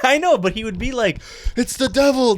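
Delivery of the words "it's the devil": high, loud mock alarm; hammed-up devil sighting; mock panic. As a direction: cry out like a spooked grandpa. Acting out tripping grandpa's panic.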